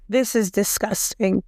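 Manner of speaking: ordinary talk, nothing marked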